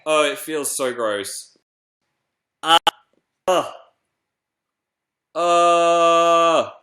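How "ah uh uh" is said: short yelps, then one long high squeal; disgusted squeals. Real, not acted. Host pulling the emu from its egg, disgusted squeals.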